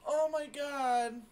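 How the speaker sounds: slow high exasperated groan. Real, not acted. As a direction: drag it out in disbelief. Disbelief after dying.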